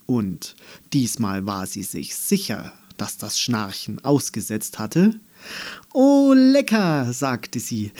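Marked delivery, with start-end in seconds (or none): 5.9-7.1 übertrieben schwärmend. Pretend. Andi lockt gespielt mit Schokolade.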